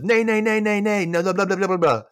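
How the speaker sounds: loud, high babbled protest; mock foreigner's blustering gibberish; gibberish refusal. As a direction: babble a rapid high-pitched no-no-no. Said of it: "and he says".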